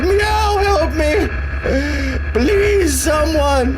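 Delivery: squeaky cat crying for help; pleading cat voice. Retold or imitated. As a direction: beg like a stranded kitty. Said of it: "And the cat's like".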